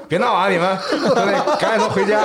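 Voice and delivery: holding back laughter while scolding; trying not to laugh; stifled giggles. Retold or imitated. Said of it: "憋着笑". (憋着笑说)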